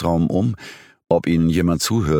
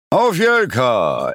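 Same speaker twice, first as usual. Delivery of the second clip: long drawn-out, higher-pitched cheer; echoed toast; 8 semitones higher, much slower than usual. A narrator's reading. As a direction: echo the toast like a whole pub. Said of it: "echote es vielstimmig zurück". (gedehnter Jubelruf als Echo)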